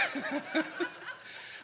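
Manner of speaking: short airy laugh at his own joke; real burst of laughter. Real, not acted. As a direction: laugh it off, high and breathy.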